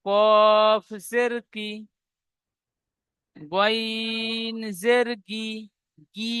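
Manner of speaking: গইন জের গি drawn out slowly; slow spelled-out syllables. Shown as a demonstration. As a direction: model গি slowly for the class. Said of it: "ই উচ্চারণ হবে". (ধীরে উচ্চারণ দেখানো)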